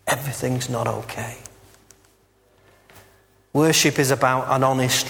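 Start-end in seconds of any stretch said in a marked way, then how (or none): 0.1-1.4 the repeat drops much softer, a broken near-whisper; sinking quiet lament; quiet despair. Acted. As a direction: let it fall away into quiet despair. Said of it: "Job screams".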